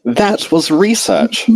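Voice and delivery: high, slow defensive retort; Twilight shooting back. A narrator's reading. Twilight defending her centipedes to Rarity, a high slow retort. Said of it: "Twilight shot back".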